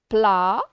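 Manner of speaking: PLA alone, loud and held; isolated syllable. Shown as a demonstration. Teacher starting the syllable exercise, isolated syllable. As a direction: give PLA as one loud, long syllable. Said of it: "Alors, je commence".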